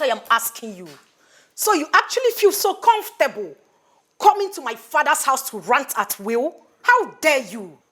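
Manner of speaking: high, loud outrage; furious shout. Acted. Woman furiously shouting at the man in her father's house. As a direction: blast him for ranting at her father's house.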